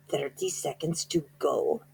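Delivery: plain reading voice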